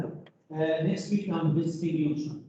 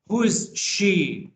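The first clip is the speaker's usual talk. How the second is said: Who is she? slow, louder, with Who pushed up; slow emphatic question; 3 semitones higher, 5 dB louder, slower than usual. Shown as a demonstration. Teacher modelling the question for a student, slow and emphatic. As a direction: drag out Who is she, stressing Who. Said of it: "teacher repeats the question slowly".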